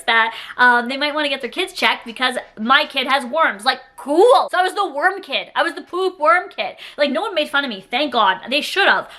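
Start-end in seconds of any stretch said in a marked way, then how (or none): none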